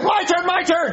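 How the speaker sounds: high shouting, laughing; excited shout. Acted. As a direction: demand your turn at the top of your voice. Kid shouting for his turn.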